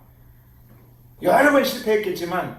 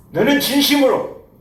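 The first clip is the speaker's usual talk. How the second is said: higher and louder, 너는 hit hard; emphatic question; 7 semitones higher, 4 dB louder than usual. Acted. Jesus questions Peter with a high, loud, stressed 너는. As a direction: press the question on him.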